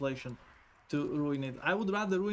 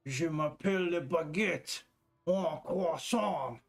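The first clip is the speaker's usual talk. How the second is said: exaggerated fake French accent, drawn out; mock French accent; slower than usual. Mocking how French sounds to chat, in a hammed-up French accent. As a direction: ham up a sissy French accent stringing random French words. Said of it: "French sounds too, too sissy".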